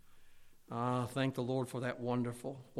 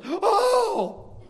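high, loud excited cry; wife's delighted gasp; 11 dB louder than usual. Retold or imitated. Husband imitating his wife's surprised joyful cry. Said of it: "she goes".

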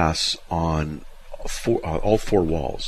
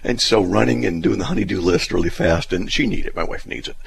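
ordinary talk, nothing marked

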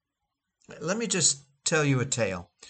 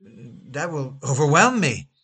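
slow, louder cry of fear; 4 dB louder, slower than usual. Retold or imitated. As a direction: Peter cries out as the wave rises. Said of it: "he cried out".

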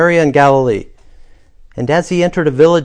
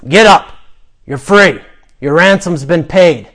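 loud, higher gruff command, falling at the end; gruff guard; 4 semitones higher, 7 dB louder than usual. Acted. Guard tells the prisoner he is free, gruff and loud. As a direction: announce it roughly and loudly, like a jailer. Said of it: "you hear a gruff voice say".

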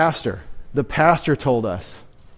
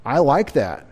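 ordinary delivery

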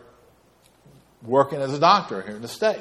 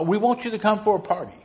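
high, quick mock-British invitation; rough British impression; attempted British accent; 9 semitones higher, faster than usual. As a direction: imitate a British friend, pitched up. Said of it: "I can't do his accent".

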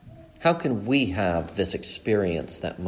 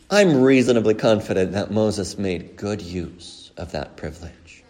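usual speaking voice

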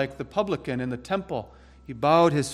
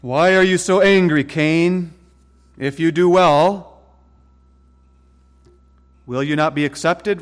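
God's loud rebuke; 3 semitones higher, 7 dB louder than usual. Retold or imitated. God's question to Cain.

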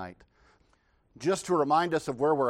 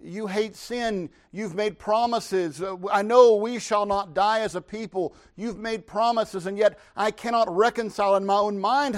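shocked Habakkuk; 6 semitones higher, 4 dB louder than usual. Acted. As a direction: plead in disbelief. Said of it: "this utterly shocks Habakkuk".

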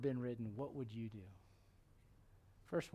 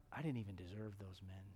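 low, hushed reflection; soft, low old man's musing; moved old David; 6 semitones lower, 10 dB quieter than usual. Acted. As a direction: think it aloud, humbled and quiet. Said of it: "You know, he thought".

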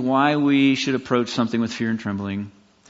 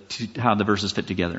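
ordinary talk

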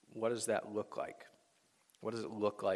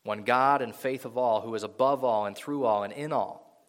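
rising, louder preacher's reading of scripture; 5 dB louder than usual. Acted. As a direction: proclaim the verses to the congregation. Reads Ephesians passage aloud.

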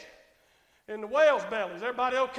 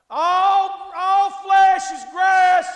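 loud, raised, drawn-out preacher's shout; shouted proclamation; 6 semitones higher, 14 dB louder, much slower than usual. Shown as a demonstration. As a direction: tell them at full force, very slowly. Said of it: "Tell 'em".

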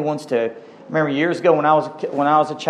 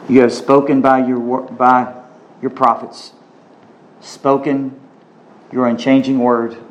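low, reverent prayer voice; 3 semitones lower than usual. Real, not acted. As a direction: pray it humbly to God. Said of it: "Well, let's pray".

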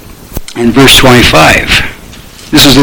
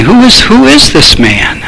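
amazed wondering; 7 semitones higher than usual. Retold or imitated. Voicing the crowd's wonder.

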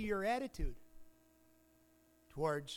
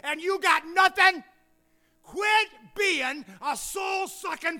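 loud, high, fiery rebuke; a loud, high, fired-up sermon climax; full-force preaching; 11 semitones higher, 14 dB louder than usual. Acted. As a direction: thunder it from the pulpit.

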